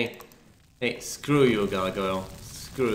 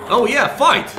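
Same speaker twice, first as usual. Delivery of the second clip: loud, high hype yell; excited cheer; 10 semitones higher, 8 dB louder than usual. Real, not acted. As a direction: cheer the fight on. Cheering on a monster fight.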